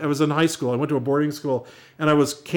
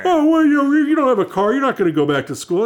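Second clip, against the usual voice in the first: mocking teens; 9 semitones higher, 5 dB louder than usual. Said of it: "They go".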